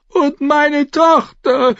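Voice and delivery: high, slow, drawn-out wail; sobbing. A narrator's reading. Mother reminding the knights of her missing daughter, sobbing. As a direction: sob it out as a desperate plea. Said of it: "schluchzte Lady Thunder".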